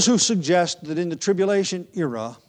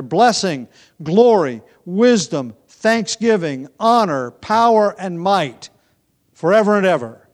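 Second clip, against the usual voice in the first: each praise word rings out louder and higher; praising proclamation; 4 semitones higher, 8 dB louder than usual. Real, not acted. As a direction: build the list of praises to a ringing forever and ever. Building list of praises to God.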